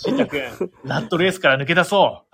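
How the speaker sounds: high, loud upbeat recruiter; recruiter pitch. Acted. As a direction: sell the dream cheerfully.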